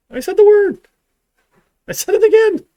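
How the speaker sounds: high, loud cry of defeat; hammed-up yelp of dismay; mock dismay. Real, not acted. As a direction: wail that you broke the word ban. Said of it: "I did it".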